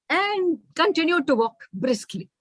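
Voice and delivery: ordinary delivery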